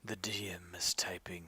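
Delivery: hushed unvoiced whisper. Real, not acted. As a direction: whisper it like a secret. Hushed aside to table.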